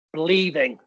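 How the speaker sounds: ordinary talk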